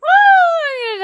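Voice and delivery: very high cheering woo; excited. Real, not acted. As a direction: whoop with real glee. Cheering before texting.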